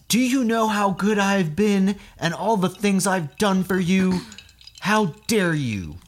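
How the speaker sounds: high, loud, slow mock outrage; imitating an abuser's wounded fury; indignant abuser. Co-host voicing a caught abuser, mock indignant and loud. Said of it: "and said".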